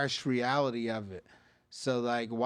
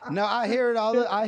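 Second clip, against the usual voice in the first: talks through laughter, higher and louder; laughing; 8 semitones higher, 4 dB louder than usual. Real, not acted. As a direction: laugh while you answer. Laughing at the joke.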